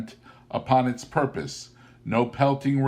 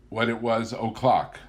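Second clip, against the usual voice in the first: ordinary delivery, nothing marked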